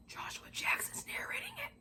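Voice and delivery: breathless, dreamy whisper; swooning whisper. Real, not acted. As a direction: whisper it like a starstruck fan. Gushing over a celebrity narrator.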